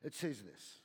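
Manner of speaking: ordinary delivery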